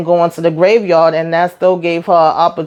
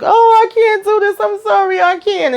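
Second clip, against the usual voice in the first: high, whimpering imitation of crying; hammed-up tearful apology, pitched way up; mock tearful breakdown; 14 semitones higher than usual. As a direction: mimic someone about to burst into tears. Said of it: "And she starts crying".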